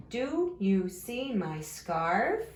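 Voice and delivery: slow question, SCARF lifting upward; question rise on SCARF. Shown as a demonstration. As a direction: read it slowly with the voice up on SCARF. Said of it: "your voice is going up at the end".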